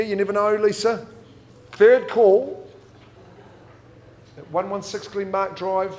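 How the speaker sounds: brisk auction patter; auctioneer calling bids. Acted. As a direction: sell it from the rostrum.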